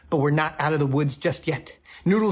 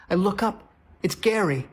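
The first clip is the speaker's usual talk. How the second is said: ordinary talk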